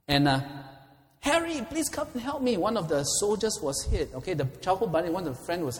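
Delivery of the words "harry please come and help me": high-pitched call from a wounded soldier; cry for help. Acted. Wounded soldier calls his buddy from the darkness, high cry for help. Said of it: "there was a cry".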